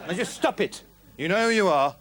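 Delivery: high-pitched, scolding rant; fed-up host at breaking point; exasperated outburst. Acted. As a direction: lose patience and tell them all off. Host explodes at uptalking guests.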